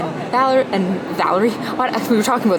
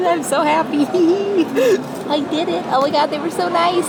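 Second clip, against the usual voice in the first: giddy; 8 semitones higher than usual. Real, not acted. Still giddy after fan meeting.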